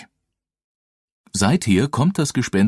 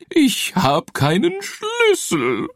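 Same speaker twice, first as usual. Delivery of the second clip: high, slow wailing ghost voice; weeping ghost; slower than usual. Acted. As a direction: wail it like a sobbing little ghost. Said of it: "heulte das Gespenst".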